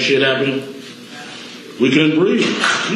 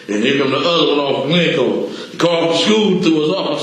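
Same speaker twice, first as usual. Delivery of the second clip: ordinary talk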